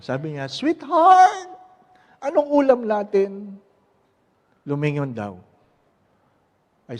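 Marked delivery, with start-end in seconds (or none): 0.5-3.6 high, loud call to a spouse; "sweetheart" sung out high and loud, then easing; calling from afar. Acted. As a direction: call out across ten steps. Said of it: "Sampung hakbang".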